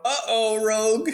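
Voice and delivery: high-pitched mock-worried "uh-oh"; teasing alarm. Real, not acted. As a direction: sing out a playful warning. GM teases player facing saving throw.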